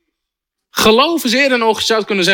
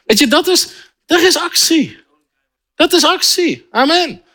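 high-pitched, challenging preacher; fervent preaching; 7 semitones higher than usual. Real, not acted. Preacher confronting overly passive Dutch believers, fervent. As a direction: challenge the congregation with insistent, raised pitch.

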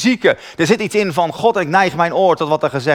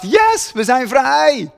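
higher and very slow, jubilant; joyful release; 7 semitones higher, much slower than usual. Retold or imitated. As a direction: let the spirit cheer. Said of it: "Mijn geest dacht". (hoger en heel langzaam, juichend van bevrijding)

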